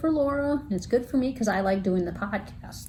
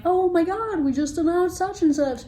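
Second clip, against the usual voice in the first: higher-pitched gushing quote; excited announcement; 8 semitones higher than usual. Acted. Host imagining a break-time update for listeners, excited announcement. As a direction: gush it like breaking news.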